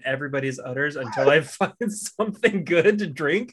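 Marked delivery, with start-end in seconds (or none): 1.3-3.5 laughing. Real, not acted. Joke about udders.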